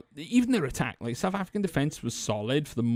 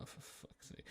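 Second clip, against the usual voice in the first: exasperated whisper; 25 dB quieter than usual. Real, not acted. Exasperated aside.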